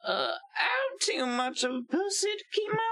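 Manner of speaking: put-on very high, fast Southern-accent caricature; hammed-up whiny Southern squeal; Lindsey Graham. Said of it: "my southern accent".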